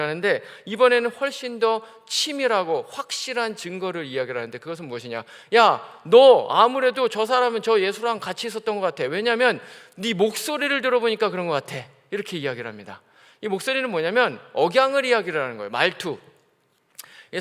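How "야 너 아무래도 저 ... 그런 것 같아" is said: accusing bystander. Acted. Crowd accuses Peter.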